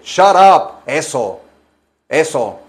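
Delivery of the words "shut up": fuerte y agudo, cortante; suéltalo con fuerza; shut up gritado. Pretend. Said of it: "Como hombre". (grito de "shut up")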